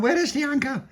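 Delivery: very high questioning voice; voiced character. A narrator's reading. Tony asks in story.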